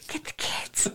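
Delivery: high unvoiced whisper, urgent but hushed; whispered warning. Wife mimicking the soft-spoken husband during a fire, whispering. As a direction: hiss out the warning softly.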